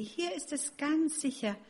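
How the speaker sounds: high birdlike chirp; chirpy sparrow wife. Acted. Sparrow wife reassures Max, high and chirpy. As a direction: sing it like a tiny sparrow. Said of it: "zwitscherte sie".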